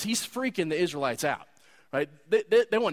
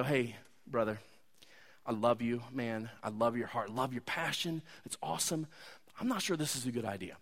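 soft, lower and tender, a little fast at the end; gentle older brother; 6 semitones lower, 8 dB quieter than usual. Retold or imitated. Older brother gently cautioning his younger brother, soft and tender. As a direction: pull him aside and reassure him. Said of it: "pull him aside gently and go".

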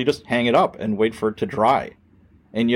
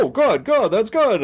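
much higher, chirpy pleased voice; mock satisfied FTO; 11 semitones higher than usual. Training officer cheerfully approving the rookie, mock high voice. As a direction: play the oblivious trainer, all cheer. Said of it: "He's like".

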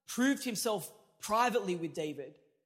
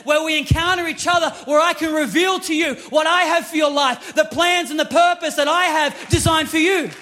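booming, pleading voice of God, higher and louder; 7 semitones higher, 10 dB louder than usual. Retold or imitated. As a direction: call them in as God would, at full force. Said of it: "No, God's like".

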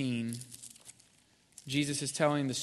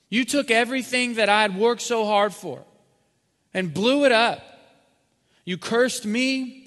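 furious shouted rant, much higher and louder; angry father; 8 semitones higher, 9 dB louder than usual. Retold or imitated. Preacher voicing an imagined father who disowns his son, furious shout. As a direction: bellow it like a betrayed father banishing his son. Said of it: "What do you think the father's gonna say?".

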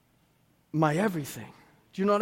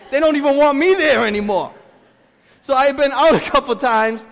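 much louder, higher, fervent; loud preaching; 6 semitones higher, 10 dB louder than usual. Acted. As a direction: preach it with full force. Preaching at full force.

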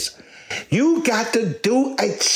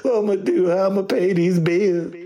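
put-on sobbing lament, starting high; mock crying; 4 semitones higher than usual. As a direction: imitate a woman wailing over her man. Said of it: "crying like".

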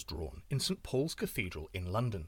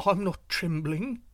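unsteady voice; 5 semitones higher than usual. Acted. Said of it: "with an unsteady voice".

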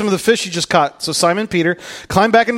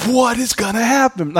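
mock dread; 7 semitones higher than usual. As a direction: blurt it high, in mock suspense. Acts out anxious onlooker awaiting showdown.

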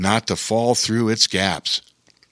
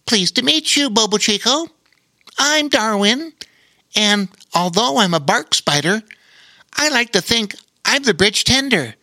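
much higher little spider, proud and polite; a tiny spider introducing himself; friendly spider voice; 10 semitones higher than usual. Acted.

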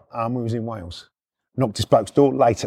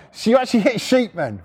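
high-pitched, imitating a tickled mate; imitated giggly questioning; 7 semitones higher than usual. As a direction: ask it as if fighting the giggles. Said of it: "just can't stop laughing".

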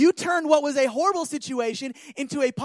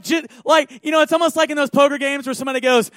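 ordinary talk

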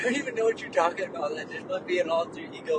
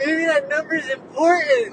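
loud, high, drawn-out protest; mock urgent insistence; 8 semitones higher, 10 dB louder, slower than usual. Partner defending the sleep-talk number, playful loud insistence. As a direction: insist slowly at raised volume.

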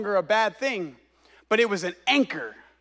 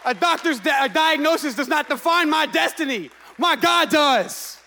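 fiery full-voice sermon; preaching; 5 semitones higher, 3 dB louder than usual. Acted. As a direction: preach with building conviction. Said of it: "I got a little carried away there".

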